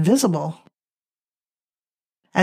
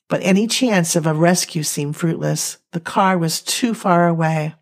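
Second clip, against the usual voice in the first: plain reading voice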